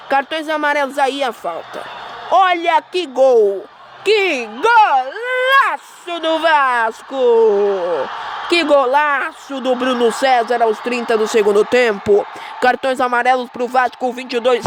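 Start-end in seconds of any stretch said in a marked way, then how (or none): 2.3-12.2 explosive goal commentary. Acted. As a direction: stretch out golaço with excitement.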